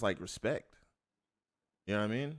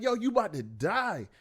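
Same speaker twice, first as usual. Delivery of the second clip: shocked; 9 semitones higher than usual. Retold or imitated. Shocked inner thought.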